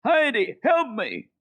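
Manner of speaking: high, loud cry for help; boy screaming for help, high and loud. Acted. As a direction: yell for Heidi as the goat slips. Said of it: "Peter screamed".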